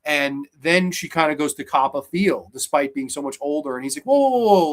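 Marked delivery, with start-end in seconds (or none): 4.1-4.7 loud, high string of whoas; retold startled back-off, voice jumping up; alarmed protest. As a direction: fend someone off, startled and loud. Man fending off a sudden advance.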